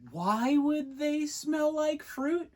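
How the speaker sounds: high, slow, drawn-out puzzled question; high bewildered voice; baffled. Retold or imitated. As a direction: sound truly confused. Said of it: "I was like".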